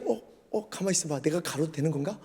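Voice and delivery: hushed, hesitant self-questioning; sudden doubt. Retold or imitated. Peter out on the water, muttering in sudden doubt. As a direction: mutter warily as second thoughts creep in. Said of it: "갑자기 두려웠나 봐요".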